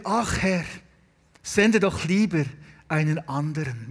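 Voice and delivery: low, subdued plea trailing down; reluctant plea. Retold or imitated. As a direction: imitate a reluctant man begging off. Moses' last reluctant excuse to God.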